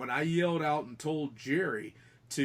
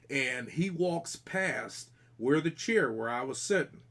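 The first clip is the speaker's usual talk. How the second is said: usual speaking voice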